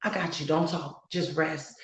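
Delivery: God's comforting voice. Acted. God soothing a weary believer who cannot pray, softly and tenderly. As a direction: speak softly as God soothing a tired child. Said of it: "he just wanna be that one to say".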